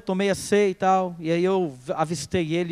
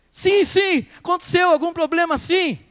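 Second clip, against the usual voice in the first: urgent confession; 8 semitones higher than usual. Retold or imitated. Anxious urgent answer.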